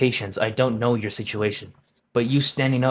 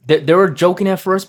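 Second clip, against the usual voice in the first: excited outburst; 6 semitones higher, 7 dB louder than usual. Real, not acted. Excited recap of the scene.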